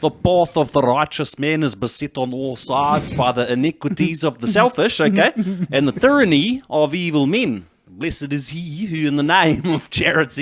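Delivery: put-on lightly done South African accent; a hint of South African, slow and preachy. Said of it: "but in a South African accent".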